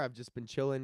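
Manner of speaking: ordinary talk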